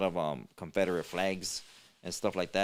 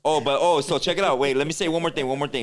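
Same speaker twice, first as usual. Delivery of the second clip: loud, excited burst to tell one more thing; eager cut-in; 5 semitones higher, 6 dB louder than usual. Real, not acted. Cutting in on the co-host, loud and eager. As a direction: jump back in louder, keen to share.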